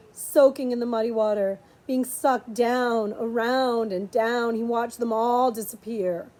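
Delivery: usual speaking voice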